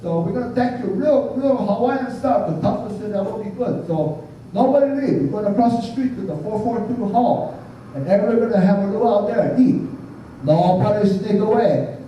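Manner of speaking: high, pidgin-tinged invitation to the luau; mildly imitated island pidgin host; Hawaiian pidgin announcement. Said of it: "the big Samoan guys come and say".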